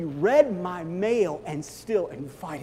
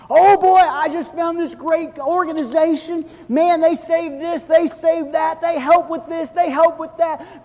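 hammed-up gushing, high and loud; mock enthusiasm; 11 semitones higher, 9 dB louder than usual. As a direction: rave about a new cause. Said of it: "Man, I'm thirsty again".

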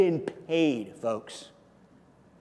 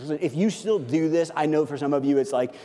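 ordinary talk, nothing marked

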